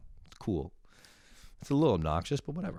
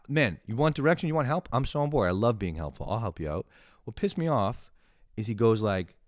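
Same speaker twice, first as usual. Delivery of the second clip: ordinary delivery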